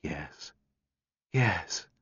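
hushed, barely voiced yes; whisper. A narrator's reading. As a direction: breathe the words almost silently. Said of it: "her voice a whisper".

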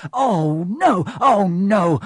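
a jolt of panic, oh no; panicked cry. Acted. Ron realising Malfoy has the letter, panicked cry.

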